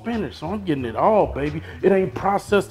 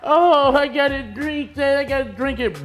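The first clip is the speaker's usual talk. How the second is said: whiny complainer; 5 semitones higher than usual. Retold or imitated. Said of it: "I know a lot of y'all say".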